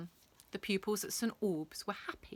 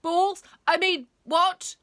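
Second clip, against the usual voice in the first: startled blurt; 10 semitones higher, 12 dB louder, slower than usual. Acted. Teacher caught off guard.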